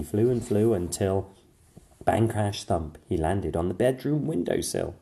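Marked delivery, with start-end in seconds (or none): none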